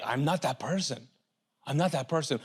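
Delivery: low soft denial of your own thoughts; quiet self-assurance. Retold or imitated. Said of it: "because you're like".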